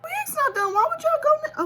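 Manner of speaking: high loud protest at family. Real, not acted. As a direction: shout back in protest.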